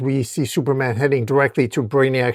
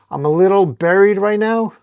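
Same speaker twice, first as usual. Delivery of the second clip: higher, straining voice; pitched-up, harried quip; strained reply; 6 semitones higher, 4 dB louder than usual. Acted. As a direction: call back from under a heavy load. Trapped Spider-Man answers under rubble.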